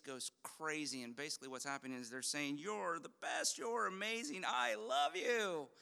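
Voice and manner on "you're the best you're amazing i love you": gushing judge. Retold or imitated. Judges praising the golden buzzer act.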